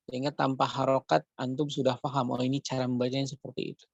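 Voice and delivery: ordinary delivery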